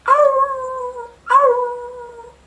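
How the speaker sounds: high, yelping dog howl; a bad but earnest impression of a dog howling. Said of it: "The dog is howling".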